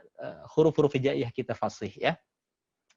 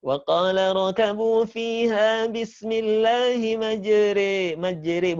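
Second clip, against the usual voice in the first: slow, higher chanted tilawah; Quran recitation; 5 semitones higher, much slower than usual. Shown as a demonstration. (lantunan tilawah pelan dan tinggi)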